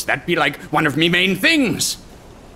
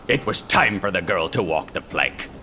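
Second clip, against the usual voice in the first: ordinary delivery, nothing marked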